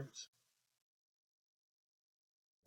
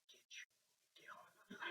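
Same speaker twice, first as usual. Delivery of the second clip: hushed, barely voiced threat; mother's menacing whisper; 33 dB quieter than usual. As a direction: lean in close and whisper the order, imitating a mother. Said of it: "lean over".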